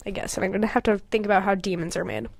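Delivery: fast whisper. Real, not acted. Planning her story aloud, whispered fast. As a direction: rush it out in a whisper.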